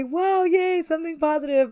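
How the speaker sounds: high, bright cheer of sudden good news; mock excitement. Gamer reacting to a fake lottery win, mock cheering. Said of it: "I was about to say".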